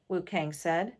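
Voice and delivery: usual speaking voice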